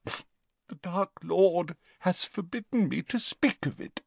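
desperate Narcissa. Acted. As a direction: beg him, voice cracking with despair.

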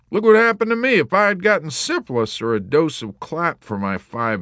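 high, aggrieved complaining; warrant officer. A narrator's reading. Warrant officer griping about his malaria, aggrieved. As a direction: rail at the unfairness.

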